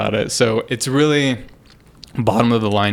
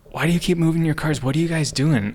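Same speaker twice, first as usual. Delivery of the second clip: fast, higher prying questions, half-acted; mock suspicious questioning; 6 semitones higher, faster than usual. Neighbor interrogating him about the cars, quick higher mock suspicion. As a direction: rattle off the questions like a nosy neighbor. Retold nosy neighbor again.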